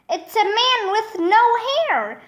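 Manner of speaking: high, excited mild shout. A narrator's reading. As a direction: call out the sighting, pitch up. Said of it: "Michael shouted".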